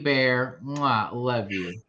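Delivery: normal talking voice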